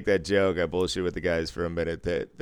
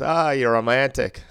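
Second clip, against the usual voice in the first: loud, higher fake Irish voice; bad Irish accent; 5 semitones higher, 6 dB louder than usual. Irish guest reacting to the dealer's movie, bad Irish accent. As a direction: mimic an Irishman, badly and loudly. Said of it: "wanna hear a bad Irish accent".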